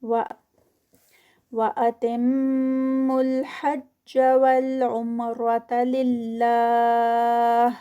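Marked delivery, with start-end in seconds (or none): 1.5-7.7 Quran recitation. Shown as a demonstration. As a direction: recite it with careful elongation. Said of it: "وَأَتِم پر غنہ".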